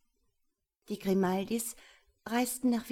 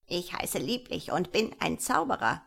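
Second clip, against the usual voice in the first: swooping, deliberate gnome voice; little wizard gnome. Acted. Gnome introduces himself to the sisters, swooping creature voice.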